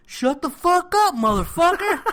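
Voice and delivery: loud, high, drawn-out mock scream; yelling. Hurt yelling during the interrogation, imitated angry scream. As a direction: scream it from off screen, hammed up. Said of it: "you hear all this yelling".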